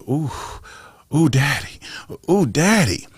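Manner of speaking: a hint of a young girl cooing daddy. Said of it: "a young girl will tell you".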